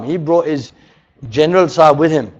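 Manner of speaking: ordinary talk, nothing marked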